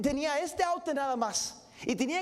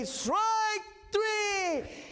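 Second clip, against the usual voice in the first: shouted strike call; 6 semitones higher than usual. Retold or imitated. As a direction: call the last strike so the whole park hears. Umpire calls the final strike.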